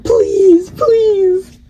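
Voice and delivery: high, loud begging please; desperate pleading. Retold or imitated. Goddess begging Bishamon not to lock her in the mirror, high and desperate. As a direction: beg like you're about to be locked in the mirror.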